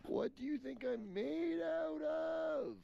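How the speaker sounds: high, slow frustrated yell; exasperated shout. Real, not acted. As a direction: shout it to the sky. Yelling about the cold.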